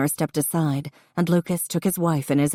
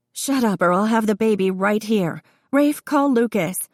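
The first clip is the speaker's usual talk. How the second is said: high, urgent order; labor-pained snapping; 5 semitones higher, 3 dB louder than usual. A narrator's reading. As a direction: snap it high, mid-labor. Woman in labor snaps orders.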